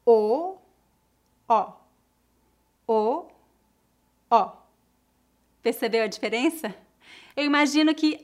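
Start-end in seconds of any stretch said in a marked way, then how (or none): 0.0-0.6 a long open vowel O. Shown as a demonstration. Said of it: "Preste atenção aos meus lábios". 1.5-1.7 closed O, lips rounded; a short closed vowel O. Shown as a demonstration. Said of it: "Preste atenção aos meus lábios". 2.9-3.3 a long open vowel O. Shown as a demonstration. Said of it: "Preste atenção aos meus lábios". 4.3-4.5 closed O, lips rounded; a short closed vowel O. Shown as a demonstration. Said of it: "Preste atenção aos meus lábios".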